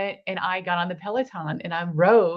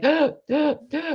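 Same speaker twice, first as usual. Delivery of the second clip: mock struggling speech; 8 semitones higher, slower than usual. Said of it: "I wouldn't be like".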